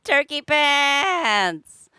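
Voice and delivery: drawn-out loud shout; excited shout. Real, not acted. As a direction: belt out the name slowly. Shouted chat name.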